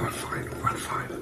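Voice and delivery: soft whisper with mouth full. Real, not acted. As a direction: whisper it almost silently. Whispered while eating.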